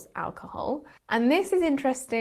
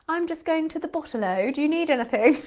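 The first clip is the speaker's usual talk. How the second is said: lightly hammed-up British accent, higher; mock British bottle-o; 6 semitones higher, faster than usual. Joking about how bottle-o sounds in a British accent. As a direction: mimic a British person saying bottle-o. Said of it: "It would sound so stupid in a British accent".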